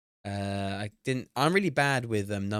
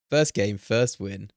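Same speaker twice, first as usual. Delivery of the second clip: loud and slow, stretching each word out; slow savoring; 5 dB louder, slower than usual. Real, not acted. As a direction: savor "First game, first win" word by word. Repeating the boast slowly.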